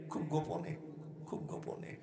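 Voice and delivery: very soft, fading to a whisper; hushed secrecy. A narrator's reading. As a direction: drop the voice as if keeping a secret. Narrates secret following, voice drops. (চাপা গলায়, ফিসফিস করে)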